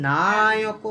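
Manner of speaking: "না" in "Naik" held very long; drawn-out syllable. Shown as a demonstration. Teacher showing a lengthened open syllable, "না" drawn out. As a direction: stretch the first syllable of "Naik". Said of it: "সেটাকে আমরা টান দিয়ে".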